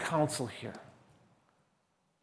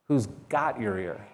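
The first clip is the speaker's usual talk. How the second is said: quieter, lower, with "got" set apart and stressed; 4 semitones lower, 5 dB quieter than usual. Shown as a demonstration. Preacher asks who you are committed to, stress on got. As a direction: lean on "got" after a tiny break. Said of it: "A different emphasis on different words".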